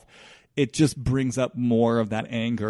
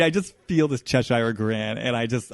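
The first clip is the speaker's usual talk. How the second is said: normal talking voice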